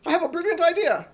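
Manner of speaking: high, loud, fast eager burst; excited. Acted. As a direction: blurt it out thrilled. Excited at his idea.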